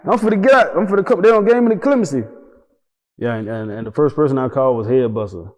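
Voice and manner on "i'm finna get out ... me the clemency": high, loud, fast excited news. Retold or imitated. Calling friends after clemency, high and loud excitement. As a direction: shout the good news high.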